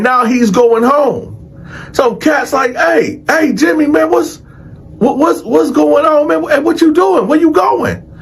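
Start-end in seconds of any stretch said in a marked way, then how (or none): 2.7-8.1 high-pitched, stammering string of questions; alarmed calling out. Retold or imitated. Inmates calling to the man leaving, alarmed questioning. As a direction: imitate a worried inmate calling after someone.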